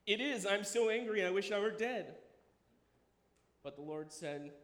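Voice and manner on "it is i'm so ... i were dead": furious Jonah. Acted.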